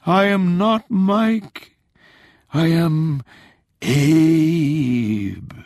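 high, slow, loud ghostly drawl; spooky ghost voice. Acted. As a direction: ham up a haunting Lincoln ghost. Tolland playing Lincoln's ghost.

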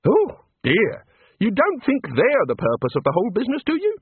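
high, bright small-god voice; cheerful little god. A narrator's reading. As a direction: play an eager little craftsman god. God character voice.

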